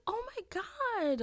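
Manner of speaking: high, slow, gasping surprise; shocked receptionist. Retold or imitated. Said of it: "They're like".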